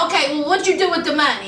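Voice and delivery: fast, impatient repeat of the question; rapid-fire repeat. Retold or imitated. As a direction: rattle the question off quickly, pressing him. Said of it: "so she was like".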